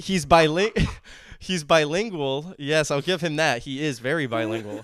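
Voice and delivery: teasing a brother, laughing. Real, not acted. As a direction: giggle while teasing his brother.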